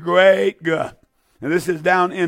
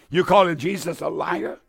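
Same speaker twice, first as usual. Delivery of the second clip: ordinary talk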